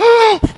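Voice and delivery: loud, high exaggerated gasp; hammed-up intake of breath; mock shocked gasp. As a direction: gasp as if horrified at being caught. Swearers learn he is a pastor.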